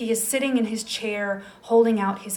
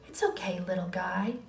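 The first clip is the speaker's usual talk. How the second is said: softer, tender reassurance; hushed child comforting a critter; soft and gentle; 7 dB quieter than usual. Acted. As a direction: soothe a tiny creature. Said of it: "Benjamin says so softly, so gently".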